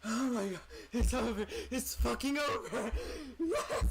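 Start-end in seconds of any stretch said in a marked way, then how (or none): 3.4-3.9 laughing and crying with joy; sobbing laugh of relief. Real, not acted. Laughing after the victory.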